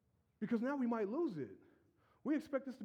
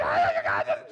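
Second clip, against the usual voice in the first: much higher, louder laughing yell; mock screaming; 10 dB louder than usual. Church people welcoming a newcomer, screaming. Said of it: "people screaming".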